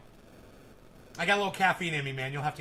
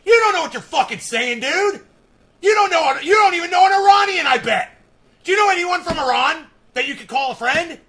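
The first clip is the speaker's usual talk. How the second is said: much higher and louder enraged yell; furious shout; 9 semitones higher, 11 dB louder than usual. Real, not acted. Shouting down a commenter who claims to know Iranians, enraged. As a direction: scream it in his face.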